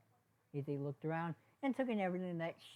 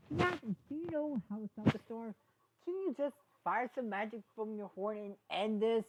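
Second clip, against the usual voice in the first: higher, louder urgent plea; pleading character voice; 6 semitones higher, 4 dB louder than usual. Acted. Sunny urging Izzy to stop the storm, higher and pleading. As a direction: beg her to end the storm.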